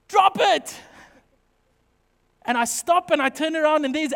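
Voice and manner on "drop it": high, loud shout from behind; wife's shout. Retold or imitated. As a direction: stop him in his tracks with a yell. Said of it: "this voice behind me go".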